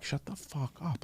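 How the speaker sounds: very hushed retort under the breath; inner voice. Retold or imitated. Ninth grader answering friend in their head, hushed mock retort. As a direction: say it as a silent thought, barely voiced. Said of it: "Like, in my head".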